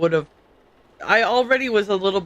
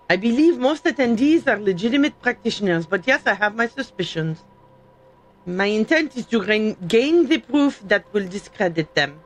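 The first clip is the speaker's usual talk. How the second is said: hammed-up French accent, reading in character; amateur mock-French accent; fake French accent. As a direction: put on a bad French accent. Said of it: "comfortable doing is the fake French".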